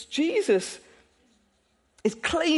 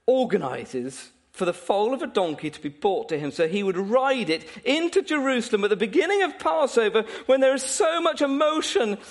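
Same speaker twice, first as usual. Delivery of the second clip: ordinary talk, nothing marked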